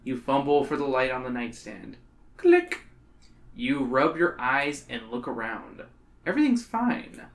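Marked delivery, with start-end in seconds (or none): none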